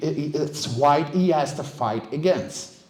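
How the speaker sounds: usual speaking voice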